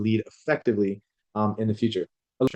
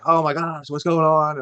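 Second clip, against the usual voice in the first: higher-pitched, acted exasperation; mock losing it; 5 semitones higher, slower than usual. Parent in a chaotic kitchen, acting out losing it. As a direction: act out a mild, high-pitched meltdown. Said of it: "I'm just losing it".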